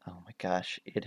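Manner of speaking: breathy whisper, no voice. Real, not acted. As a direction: whisper it like a secret. Hushed about secret project.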